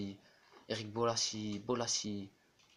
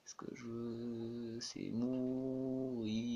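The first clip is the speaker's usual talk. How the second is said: slow, dragged-out mock despair; 3 semitones lower, 6 dB quieter, much slower than usual. Gamer after missing a chance, slow mock despair. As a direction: moan it slowly like a ham tragedian.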